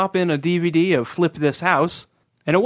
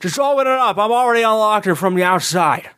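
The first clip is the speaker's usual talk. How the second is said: higher, drawly Jimmy Stewart impression; 4 semitones higher than usual.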